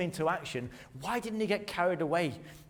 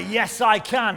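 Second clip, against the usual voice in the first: louder, higher, shouted with conviction; 4 semitones higher, 8 dB louder, slower than usual. Shown as a demonstration. Preacher urging the congregation to shout it back. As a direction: shout it like a rallying cry.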